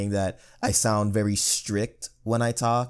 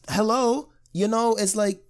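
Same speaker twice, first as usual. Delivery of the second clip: high put-on voice of outrage; mock incredulous voice; 13 semitones higher than usual. Playing along with co-host's IGN joke, in a high mock voice. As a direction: play the over-the-top believer.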